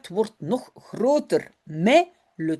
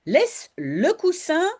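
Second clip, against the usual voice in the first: stern mother; 7 semitones higher than usual. A narrator's reading. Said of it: "dit maman".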